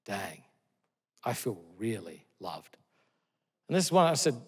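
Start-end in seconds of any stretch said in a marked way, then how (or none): 0.1-2.8 much softer, lower, slow and tearful; a son close to tears, barely above a breath; imitating a son moved to tears, hushed and slow; choked-up son. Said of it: "he cried and he said".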